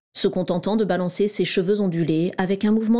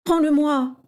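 pleading; 8 semitones higher than usual. A narrator's reading. Régulus begging James for his book, pleading. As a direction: beg to get it back. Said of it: "supplia Régulus".

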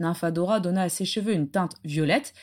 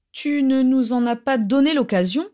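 plain reading voice

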